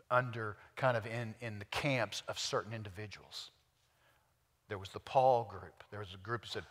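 usual speaking voice

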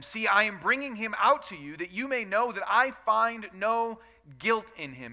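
steady, measured Bible reading to the congregation; scripture reading. Acted. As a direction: read the passage aloud with care. Reads scripture aloud.

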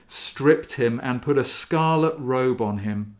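normal talking voice

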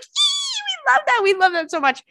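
very high squealing gush; giddy gushing. Retold or imitated. Friends swooning at the union man, high giddy gush.

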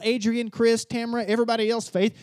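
normal talking voice

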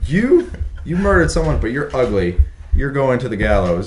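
high-pitched mock sentencing; hammed-up judge condemning someone; mock English judge. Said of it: "they're over in England. They're like".

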